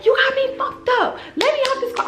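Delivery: high-pitched frantic demand; worked-up mock yell; heated. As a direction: act out demanding to be let out. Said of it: "Y'all, I'm heated".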